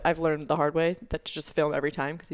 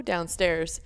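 normal talking voice

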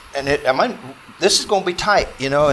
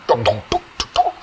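hollow knocking noises, spaced out; imitated rock knocks; 3 semitones lower than usual. Teller showing rocks rolling on the bottom, imitated clunks. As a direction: make the dull clunk of tumbling stones.

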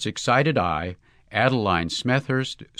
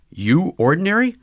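higher, louder astonished disbelief; astonished cry; 9 semitones higher, 4 dB louder than usual. A narrator's reading. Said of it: "cried Cuthbert".

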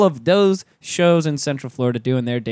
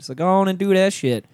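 ordinary talk

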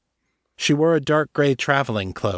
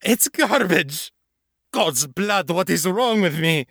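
sobbing rage; 9 semitones higher than usual. A narrator's reading. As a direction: rage through tears. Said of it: "halfway between sobs and rage".

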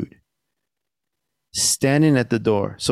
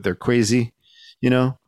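usual speaking voice

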